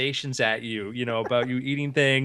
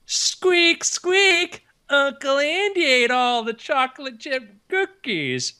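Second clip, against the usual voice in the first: much higher, louder squeaky monkey-puppet voice; high-pitched puppet voice; 12 semitones higher, 3 dB louder, slower than usual. Retold or imitated. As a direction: squeak out an accusation like a little girl's puppet. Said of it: "doing a little high-pitched voice".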